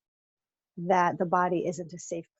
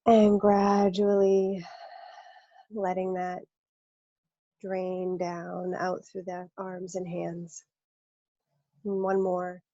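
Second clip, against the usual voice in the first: guided meditation. Acted. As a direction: let the words drain down gradually. Guides the release of tension.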